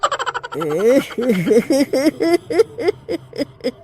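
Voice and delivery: eerie witch's cackle; the witch cackling at the wedding crowd. Acted. Witch arrives laughing.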